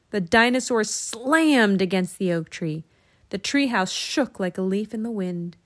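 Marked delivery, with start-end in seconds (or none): none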